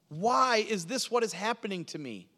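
high, pleading lament; bewildered plea to God; crying out in anguish. Acted. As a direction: cry it upward in pain, falling away at the end. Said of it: "David was crying out in fear and in pain".

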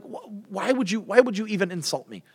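high-pitched hurt protest; acted indignant questioning; offended disbelief. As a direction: protest the insult, voice lifted. Acted Jonathan protests being insulted.

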